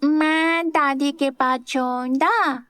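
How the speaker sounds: put-on high, slow small child's voice; a kid announcing where he'll sleep; little boy. A narrator's reading. Little boy tells his aunt he'll sleep with grandma, high and slow. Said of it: "विराज बोला".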